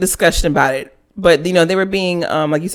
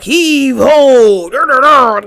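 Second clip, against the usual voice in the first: loud, hearty pirate chant; mock cartoon pirates; 2 semitones higher, 5 dB louder, much slower than usual. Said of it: "made 'em sound like pirates almost".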